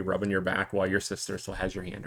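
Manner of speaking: normal talking voice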